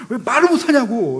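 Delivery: high, loud outraged question; furious demand. Retold or imitated. Drama hero scolding heroine outside the party, high furious shout. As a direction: blast the question at her in hammed-up rage. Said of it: "막 분노하면서".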